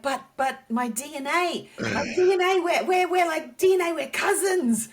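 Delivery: high, eager pleading; pleading excitement. Retold or imitated. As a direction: insist desperately on being family. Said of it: "And I was like".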